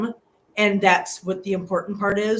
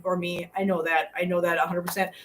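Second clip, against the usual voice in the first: usual speaking voice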